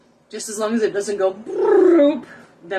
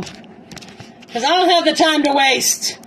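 exasperated; 7 semitones higher, 4 dB louder, much slower than usual. Real, not acted. Frustrated with failing setup.

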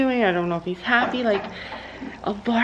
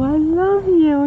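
high, slow, loving coo; tender pet talk; 7 semitones higher, 4 dB louder, slower than usual. Real, not acted. Telling horse she loves him.

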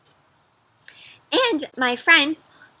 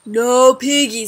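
cow voice; 3 dB louder than usual. Acted.

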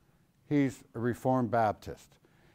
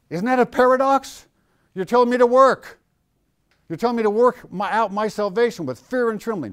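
mock objection; 9 semitones higher, 7 dB louder than usual. Teacher voices a puzzled objector.

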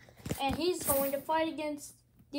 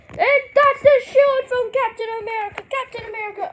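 excited commentator; 8 semitones higher, 10 dB louder, faster than usual. Acted. Commentator hyping the shield.

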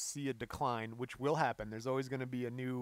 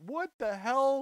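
high, louder mock bewilderment; mock disbelief; 10 semitones higher, 6 dB louder, slower than usual. Reacting to the song's sad ending, mock bewildered outburst. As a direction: toss it off in fake shock. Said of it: "I'm like".

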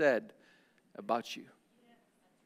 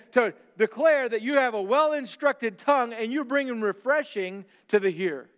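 high, loud preacher build; fiery preaching; 5 semitones higher, 4 dB louder than usual. Acted. Preacher rousing congregation to declare God's greatness, loud. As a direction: press the point home from the pulpit.

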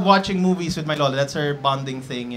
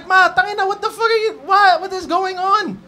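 high, loud shocked shout; shocked yell; 13 semitones higher, 6 dB louder than usual. Retold or imitated. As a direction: yell in disbelief at mom. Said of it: "I was like".

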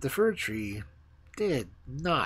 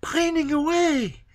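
pining fir tree; 8 dB louder than usual. Acted. The fir tree moans that it is pining away, high. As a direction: stretch it out, lovesick.

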